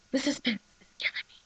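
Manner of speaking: breathy hushed title, a touch fast; dramatic whisper. Acted. Vlogger whispering her episode title for drama.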